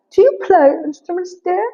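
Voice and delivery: high-pitched put-on accent; Lady Catherine. Lady Catherine quizzes Elizabeth on instruments, high affected accent. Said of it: "I don't know where I took this accent to".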